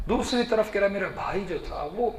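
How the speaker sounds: ordinary talk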